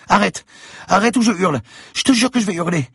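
put-on a little girl threatening to scream; threatening child. A narrator's reading. Girl threatens Franck, loud high child voice.

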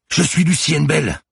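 high, loud, drawn-out yell; full-voiced desperate yell; screamed shout. A narrator's reading. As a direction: scream out your name to stop someone shooting. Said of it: "hurla le lieutenant".

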